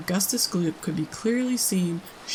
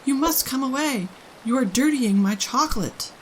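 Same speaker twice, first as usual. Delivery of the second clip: high, louder fretful urging; agitated plea; 6 semitones higher, 3 dB louder than usual. A narrator's reading. Mr Wonka urges Augustus away from his chocolate, agitated. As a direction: fret and plead at once. Wonka pleading, hopping about.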